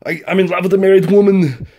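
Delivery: put-on high, loud Austrian-accented action-hero voice; Arnold Schwarzenegger. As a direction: go over the top with a booming Austrian accent. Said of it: "he talks like Arnold".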